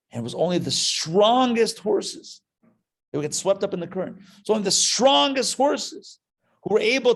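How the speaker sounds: rising to a passionate near-shout at the climax; fervent storyteller driving the tale to its peak; building excitement. Retold or imitated.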